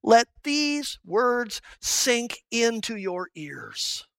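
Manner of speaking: "Let these words sink into your ears" drawn out slowly, every word weighted; the preacher repeating Jesus' charge, slow and weighty; slow emphatic quote. Acted. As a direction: give each word its full weight, slowly. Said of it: "that's why Jesus gives emphasis".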